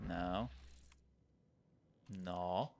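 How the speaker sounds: ordinary talk, nothing marked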